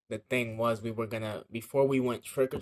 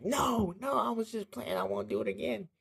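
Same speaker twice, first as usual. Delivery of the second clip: high-pitched mock begging; pleading; 12 semitones higher than usual. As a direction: plead like a kid in trouble, voice pitched way up. Retold begging to mom.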